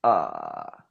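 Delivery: low creaky fry sound; vocal fry. Shown as a demonstration. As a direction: let the voice crackle into fry. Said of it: "Aí você faz o fry".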